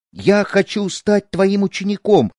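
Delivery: put-on high-pitched, humble boy; humble reply. A narrator's reading. Poor boy asking the snake charmer to take him as pupil, humble. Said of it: "смиренно ответил Рам".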